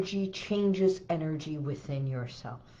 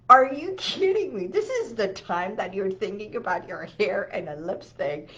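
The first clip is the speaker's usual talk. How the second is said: laughing disbelief; 5 dB louder than usual. Retold or imitated. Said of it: "I started laughing, and I'm like".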